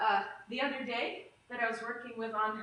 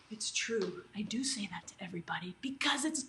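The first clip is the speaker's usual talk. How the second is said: hushed, unvoiced confession of her habit; whispered aside; 5 semitones lower, 7 dB quieter than usual. Real, not acted. Instructor to her class, admitting it in a whisper. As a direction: drop to a whisper to own up. Aside while teaching about hips.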